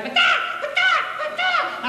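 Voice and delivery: very high, piercing bird squawks; parrot squawks. Acted. Parrot heard from behind the curtain, squawking. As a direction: screech like a parrot under attack.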